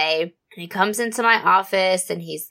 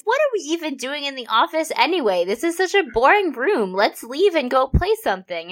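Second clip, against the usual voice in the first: playful invite; 8 semitones higher than usual. Retold or imitated. Mom's playful change of mood.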